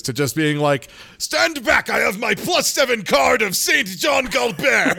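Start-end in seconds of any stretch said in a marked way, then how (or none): 1.2-5.0 high-pitched, booming mock exorcism; mock exorcist shout. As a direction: shout it like a hammed-up exorcist battle cry. Mimicking character's jump to exorcist.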